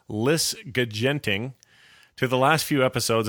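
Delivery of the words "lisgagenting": lisgagenting said slowly, syllable by syllable; mispronounced. Shown as a demonstration. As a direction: drawl out lisgagenting as a misreading. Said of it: "or in his words".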